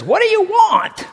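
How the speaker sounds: a high, loud call up the stairs; yelling upstairs. Retold or imitated. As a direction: yell it up to the partner upstairs, slowly. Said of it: "you yell up from downstairs".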